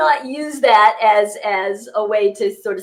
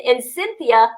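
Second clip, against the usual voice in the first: ordinary talk, nothing marked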